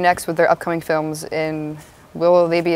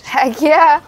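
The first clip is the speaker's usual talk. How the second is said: excited; 9 semitones higher, 6 dB louder than usual. Real, not acted. Enthusiastic yes to doing honors.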